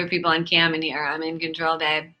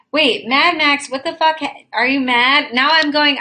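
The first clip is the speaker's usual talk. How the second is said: much higher, louder rapid questions; agitated questions; 6 semitones higher, 4 dB louder than usual. Real, not acted. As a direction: fire the questions off in alarm. Alarmed questions to a viewer.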